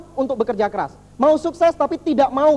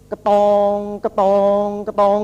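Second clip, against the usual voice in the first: drawn-out, evenly repeated metallic pounding; imitated pile-driver clang; 4 dB louder, much slower than usual. Speaker showing how foundations are built, imitated pounding. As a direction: sound out each heavy blow on one note.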